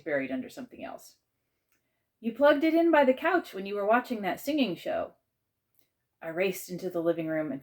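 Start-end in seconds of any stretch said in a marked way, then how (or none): 2.2-5.1 higher, louder answer across rooms; calling back. A narrator's reading. Aunt to searching child, calling back. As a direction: call the reminder through the house.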